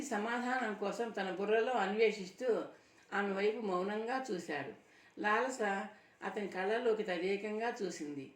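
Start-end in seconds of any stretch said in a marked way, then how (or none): none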